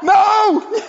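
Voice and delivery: high loud laughing no; mock protest. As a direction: cry out in mock dismay, laughing. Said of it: "And I'm like".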